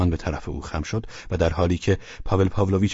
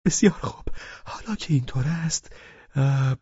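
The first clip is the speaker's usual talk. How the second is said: higher, hesitant character voice; drunkard gathering himself; 8 semitones higher than usual. Acted. Drunken guest pauses after the toast, hesitant. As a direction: muse it in a high, halting voice.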